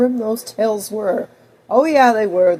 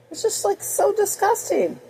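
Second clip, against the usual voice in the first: disgusted; 10 semitones higher than usual. Real, not acted. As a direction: recoil at how gross it is. Reacting to grim fairy tales.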